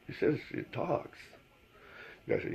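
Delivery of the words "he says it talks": slow, hushed disbelief; slow, hushed, doubtful question; incredulous whisper. Retold or imitated. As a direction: breathe it out in disbelief.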